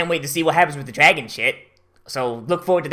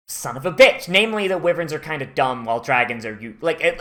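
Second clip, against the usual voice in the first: normal talking voice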